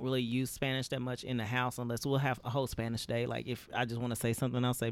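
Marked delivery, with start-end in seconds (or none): none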